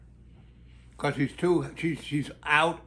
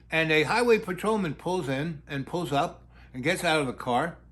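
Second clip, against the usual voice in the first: normal talking voice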